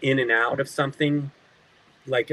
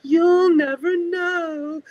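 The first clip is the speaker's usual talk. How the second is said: slow, high, eerie villain voice; hammed-up spooky threat; menacing villain line; 4 dB louder, much slower than usual. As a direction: drag it out like a creepy cartoon villain. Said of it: "And I'm like".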